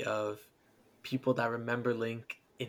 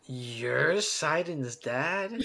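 slow, drawn-out incredulous question; mock disbelief; 2 semitones higher, much slower than usual. Player first seeing the giant king, slow disbelief. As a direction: stretch each word in comic astonishment.

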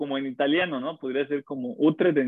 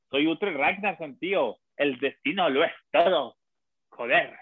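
lightly voiced Spain Spanish accent as a Viking hero; a hint of a Spain Spanish accent, playful; mock Spain Spanish Viking. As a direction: ham up a Castilian Viking, tío and joder. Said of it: "cómo sería, tío".

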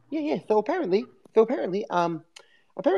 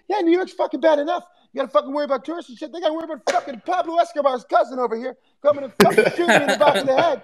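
very high, fast ranting comic voice; imitating Bill Burr. Comic roasting a fan from the stage, high and fast. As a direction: squeak out the rant at full speed. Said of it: "he goes".

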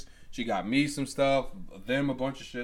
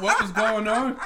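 high, loud retold bewilderment; playful high-pitched alarm; mock alarmed reaction; 8 semitones higher, 6 dB louder than usual. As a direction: raise the voice in comic disbelief. Acts own baffled reply, laughing.

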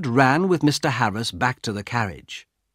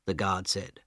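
ordinary delivery, nothing marked

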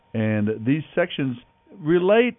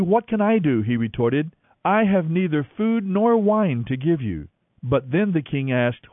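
scripture reading; 4 semitones higher than usual. Acted. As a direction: read the passage like an audio Bible narrator. Reads Bible passage over music.

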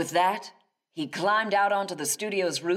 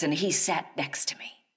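usual speaking voice

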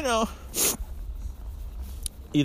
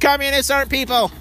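put-on child voice; 10 semitones higher, 3 dB louder than usual. Repeats the kid's punchline.

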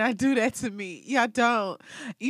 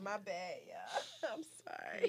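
laugh-crying; 9 dB quieter, slower than usual. Real, not acted. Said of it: "I'm crying real tears right now".

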